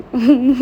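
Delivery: a sudden loud laugh; burst of laughter. Real, not acted. Aunt bursting into laughter at her niece.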